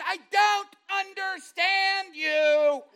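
very high, booming, drawn-out words; slow hollering to the deaf. Retold or imitated. Wife to husband she thinks went deaf, slow hollering. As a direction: shout each word long and loud. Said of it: "she thought I'd gone deaf".

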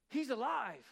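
retold onlooker's amazed exclamation; amazed cry. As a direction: call it out in disbelief. Onlooker cries out at revival.

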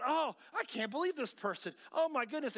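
high-pitched scandalized reader; exaggerated exclaiming at gossip; mock outrage. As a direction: act out being appalled by a post. Mimics outraged reader scrolling social media.

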